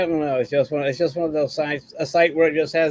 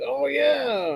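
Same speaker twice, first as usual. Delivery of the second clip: high "oh yeah" as it clicks; mock dawning realization; sudden realization; 10 semitones higher than usual. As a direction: let it dawn, pitch up. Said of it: "it's one of those things".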